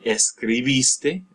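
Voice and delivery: escribiste stressed on BIS, slowly. Shown as a demonstration. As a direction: draw out the second-last syllable of escribiste. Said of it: "that A or the I".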